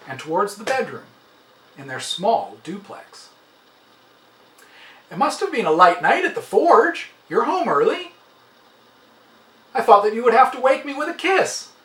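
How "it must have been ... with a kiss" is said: calling toward the hallway, a bit louder; calling out to husband. A narrator's reading. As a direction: call out fondly to someone coming home. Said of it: "she called to him".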